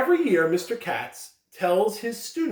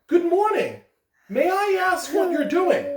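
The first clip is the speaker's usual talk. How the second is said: higher, friendly call across the beach; old man calling out; 13 semitones higher than usual. Acted. Old man on the beach calling out to the boy, lightly voiced. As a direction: call out kindly to a distant boy. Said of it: "the man called out".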